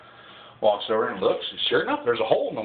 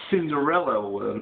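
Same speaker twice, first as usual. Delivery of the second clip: usual speaking voice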